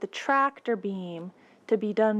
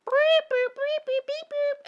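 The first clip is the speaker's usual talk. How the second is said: high chirpy beeping; droid beeps. Acted. R2-D2 answering Luke, high droid beeps. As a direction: chirp like a little robot.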